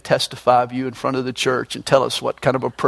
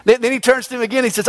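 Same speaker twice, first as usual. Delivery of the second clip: normal talking voice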